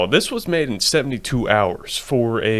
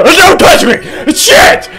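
shrieking high and loud; panicked shout; 10 dB louder, much slower than usual. Real, not acted. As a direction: yell in sudden panic. Hit by a character, yelling.

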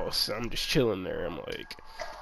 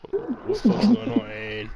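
scared; 11 semitones higher, 4 dB louder, slower than usual. Retold or imitated. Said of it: "I'm scared".

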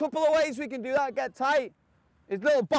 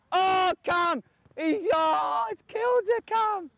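excited shout; 8 semitones higher, slower than usual. Real, not acted. Coach reacting to a tackle.

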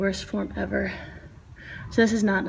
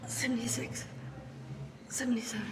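breathless whispered counting; doing push ups; 3 semitones higher, 12 dB quieter than usual. Real, not acted. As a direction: push through the strain, gasping. Straining through push-up reps.

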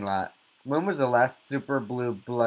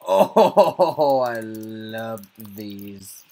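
laughing delight; 7 dB louder, much slower than usual. Real, not acted. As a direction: burst out then laugh through it. Laughing at game characters.

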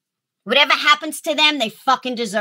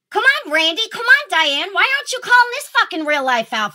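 higher, pleading-angry, rushing the last line; exasperated callout; 8 semitones higher than usual. Real, not acted. Ranting vlogger calls out her followers by name, exasperated and rushing. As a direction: call them out by name, fed up and speeding up. Angry rant calling out viewers.

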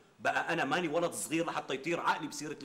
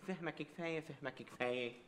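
quiet coy wife; 7 dB quieter than usual. Retold or imitated. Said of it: "بتتغماني وبتقول لي".

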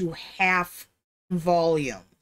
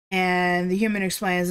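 usual speaking voice

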